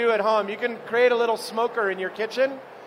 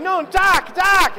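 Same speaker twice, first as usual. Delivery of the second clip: high, loud "doc, doc"; insisting shout; 9 semitones higher, 7 dB louder, much slower than usual. Retold or imitated. Danny arguing with Fabio, mock shouting "doc". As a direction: shout the correction, frustrated. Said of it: "He's like".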